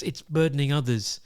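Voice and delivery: ordinary talk, nothing marked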